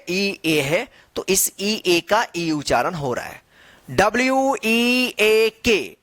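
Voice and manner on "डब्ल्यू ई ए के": डब्ल्यू ई ए के drawn out by letter; slow spelling. Shown as a demonstration. Teacher spells for learners, डब्ल्यू ई ए के stretched slowly.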